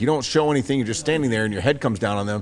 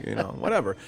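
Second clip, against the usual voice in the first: ordinary talk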